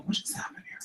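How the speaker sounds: unvoiced whispered question; whispering. Real, not acted. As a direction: whisper it aside, puzzled. Whispered aside during chat.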